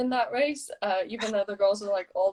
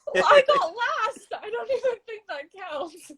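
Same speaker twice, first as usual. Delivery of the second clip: laughing; 11 semitones higher, 3 dB louder than usual. Real, not acted. Laughing at her last place.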